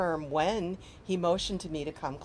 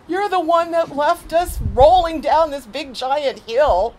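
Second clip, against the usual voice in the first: high, loud, slow comeback; teen girl talking back loudly; defiant retort; 9 semitones higher, 11 dB louder, slower than usual. Retold or imitated. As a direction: throw it back at the father. Girls retorting to father.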